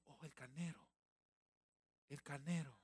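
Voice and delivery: soft, hushed realization; awed discovery. Acted. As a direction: breathe it out in wonder. Abraham discovering the ram. (Abraham descubre el carnero, asombro suave)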